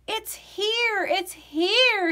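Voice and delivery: high, thrilled double shout; excited declaring. A narrator's reading. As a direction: burst out with joy as the game day arrives. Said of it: "he declared".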